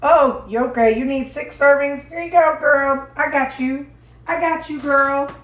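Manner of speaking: lightly voiced God saying he's got her; playful God voice. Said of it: "He's like".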